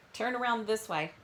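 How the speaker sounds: normal talking voice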